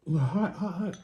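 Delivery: talking through hot food; hot food burning the tongue; mouth full of hot food. Real, not acted. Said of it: "I just burnt my tongue".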